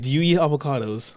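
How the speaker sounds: ordinary delivery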